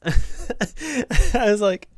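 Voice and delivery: high, loud laughing lead-in; words breaking through real laughter. Real, not acted. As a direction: crack up while telling it. Laughing at mom's banjo answer.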